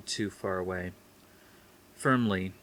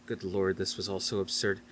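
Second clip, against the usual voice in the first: ordinary delivery, nothing marked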